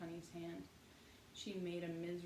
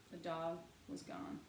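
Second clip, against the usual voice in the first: ordinary delivery, nothing marked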